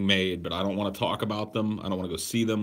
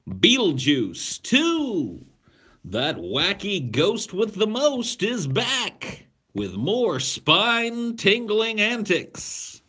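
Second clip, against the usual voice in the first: slow, loud, high hammed-up trailer voice; over-the-top announcer parody; 12 semitones higher, 6 dB louder, much slower than usual. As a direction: sell it like a cheesy movie trailer.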